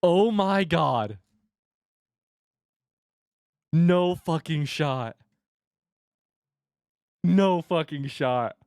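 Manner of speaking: slow, awed disbelief. Real, not acted. Streamer in awe at the rare card. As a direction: let the words hang, stunned.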